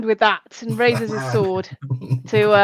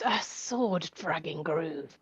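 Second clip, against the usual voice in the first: gruff deadpan; 6 semitones lower, 13 dB quieter, much slower than usual. Acted. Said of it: "Dark looks and goes".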